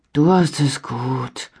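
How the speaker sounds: langsam, seufzend, leicht verstellte Stimme. A narrator's reading. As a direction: neidisch seufzen. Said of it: "seufzte Armin". (Armin seufzt neidisch)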